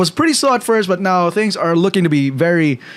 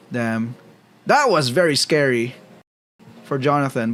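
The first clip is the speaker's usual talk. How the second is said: ordinary talk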